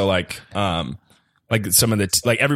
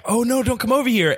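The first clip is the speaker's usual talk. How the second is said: high-pitched mock panic; acted-out alarm at ordinary volume; retold alarmed warning. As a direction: warn someone off, voice jumping way up. Retold warning to approaching girl.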